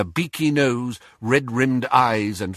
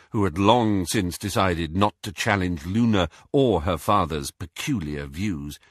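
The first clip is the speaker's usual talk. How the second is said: usual speaking voice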